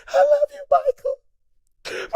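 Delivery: very high, squeaky mock-tearful voice; tiny falsetto, hammed-up emotion; tearful confession. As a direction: squeal it like an overcome fan in tears. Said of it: "I went right into his ear".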